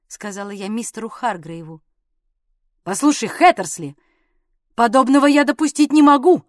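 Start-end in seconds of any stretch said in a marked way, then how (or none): 2.8-6.4 loud protest, building in force; raised-voice objection; indignant exclamation. A narrator's reading. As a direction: object firmly, voice rising. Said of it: "воскликнул этот джентльмен".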